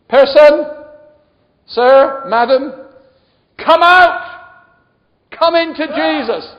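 high, very loud summons; shouted altar call. Real, not acted. Preacher summoning listeners to Jesus, ringing shout. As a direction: call them out one by one.

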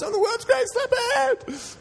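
very high, slow, laughing yell; screamed with joy. Retold or imitated. Preacher reliving the moment he screamed it, laughing, very high. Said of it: "I screamed at the top of my voice".

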